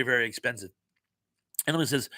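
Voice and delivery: ordinary delivery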